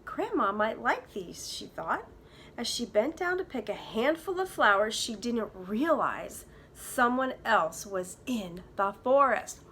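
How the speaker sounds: put-on lively read-aloud for kids; story narration. A narrator's reading. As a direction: tell it like a bedtime storybook. Teacher reads story aloud.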